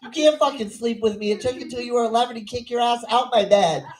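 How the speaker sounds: high-pitched, indignant refusal; mock outrage, pitched well up; exasperated mother. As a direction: scold a grown son in acted disbelief. Mother refuses grown son's request.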